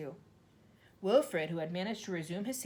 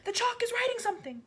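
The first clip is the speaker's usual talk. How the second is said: much higher, louder excited shout; screamed; 6 dB louder than usual. A narrator's reading. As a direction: point and scream. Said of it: "screamed Nigel".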